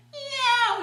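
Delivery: high, loud cat meow reply; answering meow. Acted. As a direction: meow back from the bushes. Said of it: "replied a voice from the undergrowth".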